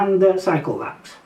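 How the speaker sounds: ordinary delivery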